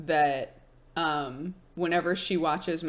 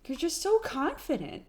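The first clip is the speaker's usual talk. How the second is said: higher soft mom imitation; mom's voice; 7 semitones higher, 4 dB quieter than usual. Mom admiring her daughter's confidence, high soft imitation. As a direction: lightly voice mom, pitched up and gentle. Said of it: "She was like".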